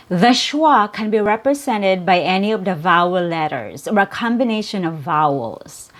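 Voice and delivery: ordinary talk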